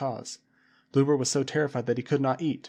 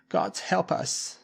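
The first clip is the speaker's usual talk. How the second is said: very slow, higher tearful plea; blubbering; 6 semitones higher, much slower than usual. A narrator's reading. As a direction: blubber it out in fear. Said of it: "blubbered Bluber".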